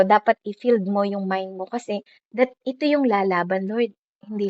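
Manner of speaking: ordinary talk, nothing marked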